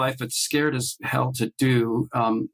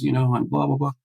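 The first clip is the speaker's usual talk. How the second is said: normal talking voice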